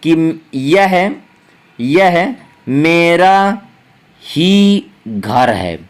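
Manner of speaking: "यह मेरा ही घर है" spoken very slowly, with a heavy pause after "यह" and the stress landing on "ही"; emphasis on ही. Shown as a demonstration. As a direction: drag the whole phrase out slowly, leaning hard on "ही". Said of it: "जैसे हम कहते हैं".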